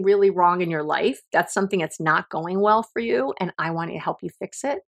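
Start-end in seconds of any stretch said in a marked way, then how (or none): none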